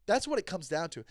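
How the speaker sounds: ordinary talk, nothing marked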